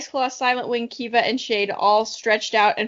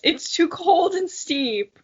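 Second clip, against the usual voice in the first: higher, slow whiny complaint; 6 semitones higher, slower than usual. Acted. Kiva whining about crossing mountains, whiny voice. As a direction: whine it like a tired kid. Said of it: "Kiva complained in a whiny voice".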